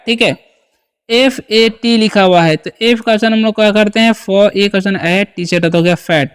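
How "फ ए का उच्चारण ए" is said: usual speaking voice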